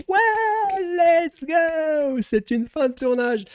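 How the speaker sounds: very high, drawn-out falsetto cheer; retold wrap cheer. Streamer re-enacting the applauding crew at wrap, high cheering. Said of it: "on a fait".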